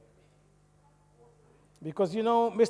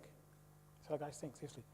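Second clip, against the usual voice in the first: whisper; 16 dB quieter than usual. Real, not acted. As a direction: lean in and whisper it like a secret. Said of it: "repeated warning, whispered".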